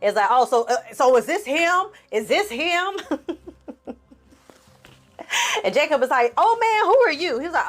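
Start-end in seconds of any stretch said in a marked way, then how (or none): 0.3-3.0 high rising mock outrage; jealous husband confronting. Voyo pulling up on Jacob, high mock outrage. 6.3-7.4 high, loud startled who are you; startled young man. Retold or imitated. Jacob caught by Voyo, high startled yelp.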